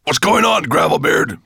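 high, loud monster bellow; booming. A narrator's reading. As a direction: boom a demand at your servant. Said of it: "boomed Nettlebrand's voice".

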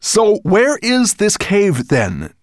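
high, loud and slow, impatient; impatient dragon. Acted. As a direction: drag it out impatiently. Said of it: "asked impatiently".

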